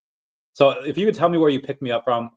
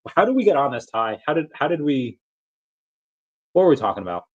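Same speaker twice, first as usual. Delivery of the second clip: ordinary talk, nothing marked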